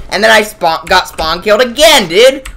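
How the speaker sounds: normal talking voice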